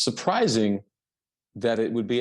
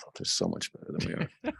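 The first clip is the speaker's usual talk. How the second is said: lower, unvoiced stage whisper of defeat; whispered aside; 13 semitones lower, 6 dB quieter than usual. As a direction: whisper it like a rueful confession. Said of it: "it's like".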